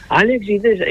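high-pitched catchphrase call; mock shout. Repeating the name his friend told him to shout, mock shout. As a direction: cry the name out high, without real volume. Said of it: "Gritá Alexis Texas".

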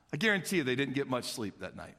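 ordinary talk

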